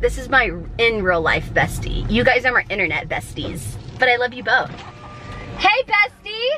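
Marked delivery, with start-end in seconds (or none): 5.6-6.6 singsong greeting. Acted.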